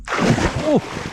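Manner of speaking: high startled cry breaking into a loud laugh; sudden shock, then loud laughter; startled yelp. Real, not acted. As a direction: jump out of your skin then laugh it off. Fish suddenly bursts out.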